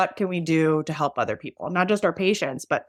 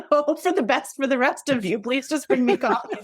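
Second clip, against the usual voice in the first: higher, laughing mock plea; joking plea; 7 semitones higher than usual. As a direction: beg off with a laugh, pitched up. Joking apology to coworkers, laughing.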